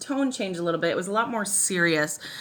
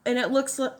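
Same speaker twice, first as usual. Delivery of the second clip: ordinary delivery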